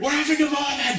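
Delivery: very high, loud mock outburst; comic shouted protest at being interrupted; indignant yell. Acted interruption in retold scene.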